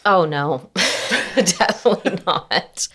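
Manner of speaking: real laugh breaking into the answer; laughing denial. Real, not acted. Wife admitting she lacks patience for slips, laughing. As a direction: laugh through the admission.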